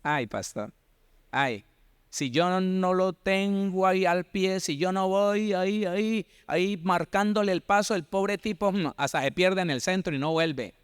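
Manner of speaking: mock a wife who must lead her husband; mock whiny wife. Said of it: "La mujer que dice".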